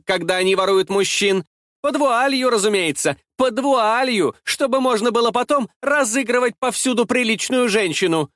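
smug, high-pitched sonorous gloating; ringing, mocking tirade at a victim; ringing, almost merry. A narrator's reading. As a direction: jeer with vulgar self-satisfaction. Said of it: "делало её голос звучным и почти весёлым".

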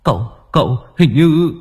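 stuttering, voice rising in fear; can't get the words out; frightened stammer. Acted. Servant boy stammering the bad news, frightened.

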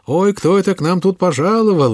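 high, loud and glad; joyful greeting. Acted. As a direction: greet the guest with delight. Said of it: "приговаривала радостно".

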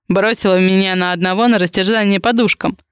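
ordinary delivery

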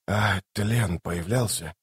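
soft, slow, hesitant question; subdued after shaky breath. A narrator's reading. Toc asking after a shaky breath, soft and slow. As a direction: ask it softly and slowly. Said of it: "Сделав глубокий дрожащий вдох".

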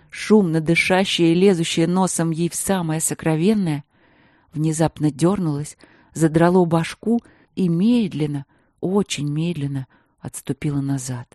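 ordinary delivery, nothing marked

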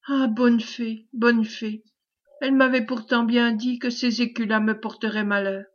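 ordinary delivery, nothing marked

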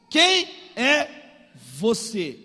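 loud, word-by-word demand; questioning father. Retold or imitated. Blind Isaac questions his son, slow, loud emphasis. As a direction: leave weight on each slow word.

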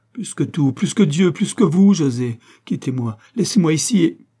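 high, loud, mounting desperation; desperate plea on the edge of tears; anguished, near sobs. A narrator's reading. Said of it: "suffoqué par les sanglots".

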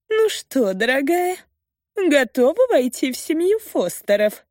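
high, sing-song mocking taunt. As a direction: sneer at her sweetly. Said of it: "насмехается графиня".

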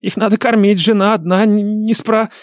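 high, frantic pleading, cut off; hurried, breathless babble. A narrator's reading. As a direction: gabble excuses until interrupted. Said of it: "торопливо задыхаясь, забубнила мразь".

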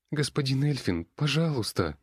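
high, pleading and tearful; tearful plea. Acted. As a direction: beg through tears. Crying girl pleads.

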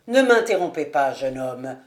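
firm minister dropping low at the end; stern rebuke. Acted. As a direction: reprove the young man gravely. (réprimande grave du ministre)

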